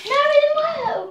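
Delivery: high, loud cry of dismay; dismayed outcry. Real, not acted. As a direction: yell out that it failed, pitch shooting up. Prank demonstration fails.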